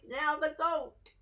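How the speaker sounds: ordinary delivery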